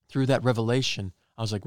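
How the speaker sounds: ordinary delivery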